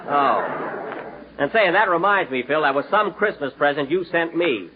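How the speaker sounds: usual speaking voice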